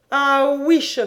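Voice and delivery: loud mocking cry of disbelief; scornful cry. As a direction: scoff it out loud. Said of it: "s'écria Jean".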